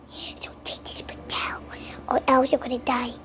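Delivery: mock-scary whispered threat; spooky whisper. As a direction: whisper a playful warning. Mock threat after the story.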